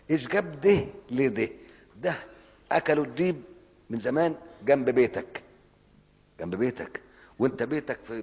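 preacher at full force; fiery preaching. Acted. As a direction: drive the point home to the crowd.

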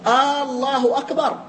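الله أكبر opened like an Arabic question particle, "aallahu akbar"; questioning long hamza. Shown as a demonstration. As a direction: stretch آه into الله أكبر as if asking. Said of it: "كأنك تسأل الآن، تستفهم".

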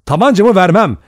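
loud, high defiant shout; loud, forceful outcry; cry out. A narrator's reading. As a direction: shout a flat refusal. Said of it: "diye haykırdı Lombard".

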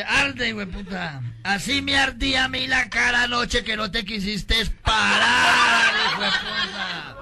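slow, loud mock tirade at a post; mock furious rant. Drunk yells at the barbed-wire post, slow mock fury. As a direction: chew out the post slowly, building.

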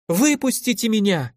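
high, panicked woman's cry. A narrator's reading. Locked-up lady calls for someone to free her, high panicked cry. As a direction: cry out to be let go. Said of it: "ударило по ушам женским криком".

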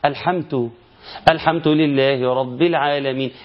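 الحمد said "elhamtu" with ت in place of د; slow mock wrong recitation; mispronunciation demo. Shown as a demonstration. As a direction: swap the د of الحمد for a ت. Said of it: "من الأخطاء اللي تسمعها".

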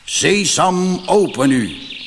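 the robber chief intones the magic words; solemn robber chief. A narrator's reading. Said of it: "trok een plechtig gezicht en zei".

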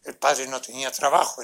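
normal talking voice

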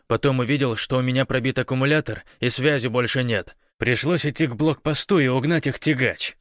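high, forceful commanding delivery; urgent radio report. A narrator's reading. Гош cuts his comrade short to report, urgent and commanding. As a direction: fire off the report over the radio.

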